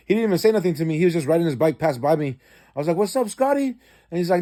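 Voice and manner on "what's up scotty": calling out. Retold or imitated. Calls again, Scotty ignores him.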